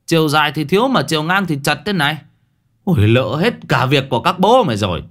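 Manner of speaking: alarmed yelling. Acted. Old man yells in alarm at the too-small coffin, high and fast. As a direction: blurt it out in panic, rushing. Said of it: "tái mặt quát".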